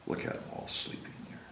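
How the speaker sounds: fast breathy whisper. A narrator's reading. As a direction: whisper so they don't wake. Whispered aside.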